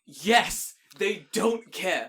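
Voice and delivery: loud, high, excited yes; emphatic agreement. Real, not acted. Cheering on a friend who gets it, loud and emphatic. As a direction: agree emphatically, drawing out the words. Delighted that the other host gets it.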